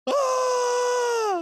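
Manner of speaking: long, high, held shriek at full voice; scream. Retold or imitated. Scott exploding out of the ground, still shrieking high and long. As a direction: keep screaming as you burst out of the ground. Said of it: "he's still screaming".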